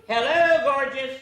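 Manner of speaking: high, loud, chirpy read-aloud; bright storybook bird voice. Acted. Teacher reading the morning greetings to the class, high and chirpy. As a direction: greet every colour of the sky like a delighted bird. Reads picture book aloud.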